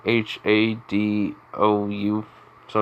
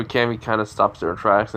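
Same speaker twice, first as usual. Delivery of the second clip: ordinary delivery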